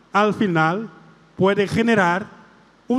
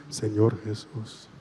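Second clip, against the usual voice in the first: much lower and softer, slow; hushed echo; 11 semitones lower, 9 dB quieter, slower than usual. Shown as a demonstration. Priest repeats the prayer line as the girl's soft reply. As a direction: breathe the answer quietly. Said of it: "Empezamos otra vez".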